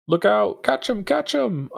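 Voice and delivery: high urgent yell; shouting. Acted. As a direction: call out to the crowd. Character yelling to comrades.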